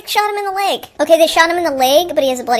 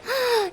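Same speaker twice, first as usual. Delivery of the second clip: a high sharp mock gasp; 9 semitones higher, 5 dB quieter than usual. The cop, shocked to find Spider-Man, with a high sharp mock gasp. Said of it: "he should have been like".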